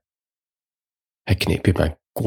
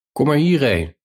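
raised, high call from afar; 7 semitones higher than usual. A narrator's reading. Jacques calls down from the hilltop, high calling out. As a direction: call back across the distance, pitched up. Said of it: "riep ik".

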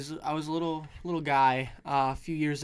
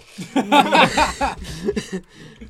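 laughing; 8 semitones higher, 10 dB louder than usual. Real, not acted. Laughing at mishap.

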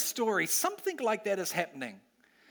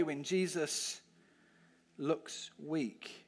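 "looks weak?" dropped much softer, a lower, searching question; 3 semitones lower, 8 dB quieter, much slower than usual. Shown as a demonstration. Preacher asking the kids, softly probing. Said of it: "repeats the phrase as a question".

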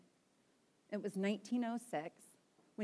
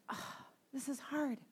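retold heartbreak; 4 semitones higher, 5 dB quieter than usual. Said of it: "with a broken heart".

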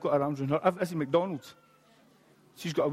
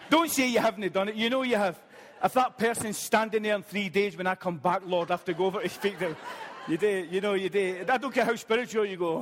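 raised, lively ribbing of the crowd; playful teasing; 5 semitones higher than usual. Real, not acted. Preacher teasing his congregation, high and laughing. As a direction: needle them cheerfully, voice up high.

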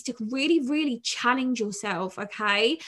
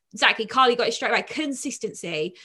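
ordinary talk

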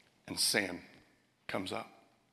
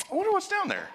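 squeaky wondering; 5 dB louder, faster than usual. Retold or imitated. Peering into murky Kansas lake.

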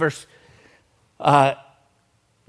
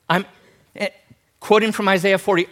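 normal talking voice

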